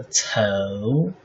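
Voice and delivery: 头 drawn out with a rising pitch; second tone. Shown as a demonstration. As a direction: stretch 头 and lift it steadily. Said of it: "second tone".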